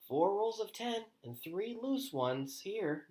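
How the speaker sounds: high, slow, pleased counting; satisfied report. A narrator's reading. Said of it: "Nicholas reported with satisfaction".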